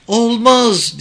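high, loud refusal; girl shouting. A narrator's reading. Girl refuses the questioner, high shout. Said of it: "diye bağırdı kız".

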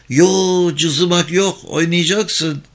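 high, loud drawn-out protest; insistent objection. Acted. Boy stopping his friend quitting, insistent protest. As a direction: refuse to let a playmate back out. Abdul refuses to let him quit.

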